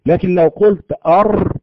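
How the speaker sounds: أر with a long trill of four to six taps; over-rolled r. Shown as a demonstration. Teacher showing the wrong rolled r. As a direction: roll the r in أر far too long, the wrong way. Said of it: "هذا خطأ".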